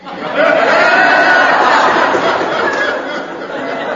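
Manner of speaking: breathy high laugh at the absurd answer; amused laugh. Real, not acted. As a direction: let out a real airy laugh.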